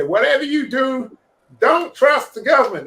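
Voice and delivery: high, loud attempt at Canadian; Canadian accent. Trucker warning others about government, Canadian accent. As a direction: warn them in a put-on Canadian accent. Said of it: "That's my best Canadian accent".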